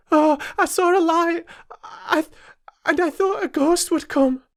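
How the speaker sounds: put-on high, trembling child's voice; a scared girl confessing her fear; frightened child. Young Jane tells the maids about the ghost, high and frightened.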